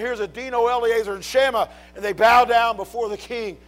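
loud, high, driving pulpit preaching; preaching at full force. Acted. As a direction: preach it hard to the congregation.

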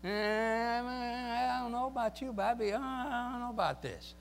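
hesitant, nervous soldier voice; timid soldier. Retold or imitated. Preacher voicing a soldier afraid of Jesus, nervous.

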